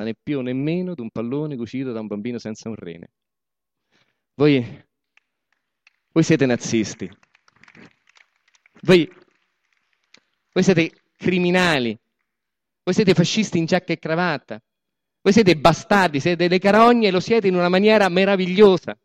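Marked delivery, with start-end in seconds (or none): 4.3-7.1 loud accusation. Acted. Accusing the audience. 8.8-19.0 escalating insults. Acted. Boss insulting the workers, rising angry tirade.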